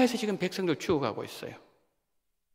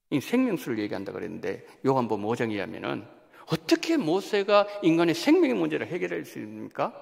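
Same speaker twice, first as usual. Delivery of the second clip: ordinary talk, nothing marked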